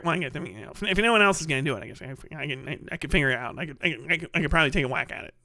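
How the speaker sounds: low, quiet, hurried mumbling; feigned reluctance. Acted. Director volunteering himself for the role, falsely modest mumble. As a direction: offer yourself bashfully under your breath, stumbling.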